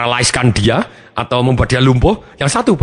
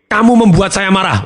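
mock angry accusation; 7 semitones higher, 3 dB louder than usual.

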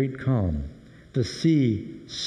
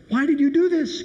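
frightened demand; 12 semitones higher, 3 dB louder than usual. Acted. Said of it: "exceedingly afraid and said".

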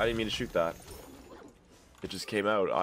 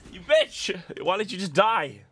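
high, loud complaint; outraged gamer outburst; exasperated yell; 11 semitones higher, 6 dB louder than usual. Real, not acted. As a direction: shout in frustration at the game. Raging at character dying in game.

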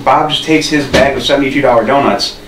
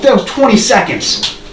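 acted disbelief; 7 semitones higher than usual. Storyteller finds his friend asleep moments later, acted disbelief. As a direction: stretch it out in raised-pitch disbelief.

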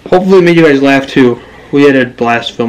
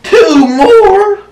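very high, disbelieving echo; incredulous squeal. Real, not acted. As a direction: squeal it back in dismay. Dismayed at two more poses.

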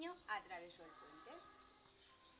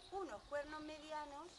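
ordinary delivery

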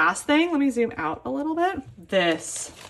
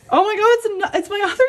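excited squeal; 9 semitones higher, 5 dB louder, much faster than usual. Real, not acted. Unboxing a long-awaited trade.

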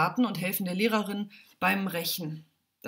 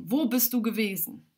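stern question. A narrator's reading. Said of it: "fragt streng".

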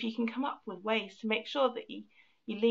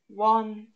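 One punched much louder; stress on beat one; 8 dB louder than usual. Shown as a demonstration. Teacher shows the class a strong first beat, One loud. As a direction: hit One hard, then let the rest drop. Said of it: "put extra oomph and extra emphasis on".

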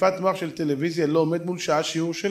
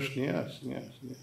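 soft, patient repeated plea; calm interruption; 4 semitones lower, 13 dB quieter, faster than usual. Retold or imitated. Speaker tries to settle the shouting father, quietly calm. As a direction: quietly ask for a moment.

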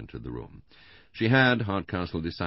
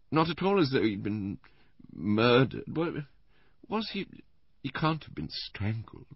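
high, halting invalid voice; Missus Bland, faltering; 10 semitones higher, 4 dB louder than usual. A narrator's reading. Invalid wife studying the dead man's photograph, faltering. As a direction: falter delicately, with long pauses.

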